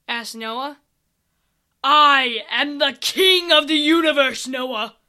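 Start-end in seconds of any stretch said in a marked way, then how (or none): 1.8-4.9 a hint of a booming louder villain; villain king. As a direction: announce yourself as an evil king. Villain announces himself.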